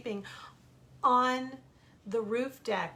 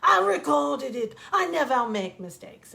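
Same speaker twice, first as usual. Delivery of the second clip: put-on loud, higher, outraged French-accented rant; imitated furious screaming; 5 semitones higher, 12 dB louder than usual. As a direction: scream it like a cameraman losing his mind. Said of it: "he is yelling and screaming and losing his mind".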